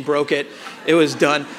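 ordinary talk

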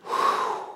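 soft exhaled whew of relief; imitating a sigh of relief; relieved whew. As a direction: blow out a quiet whew, like a weight just lifted. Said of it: "you gotta love his response here".

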